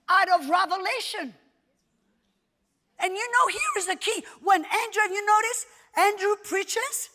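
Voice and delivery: high, loud sermon voice; impassioned preaching. Real, not acted. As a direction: proclaim it emphatically to the congregation. Preaches admiringly about a preacher.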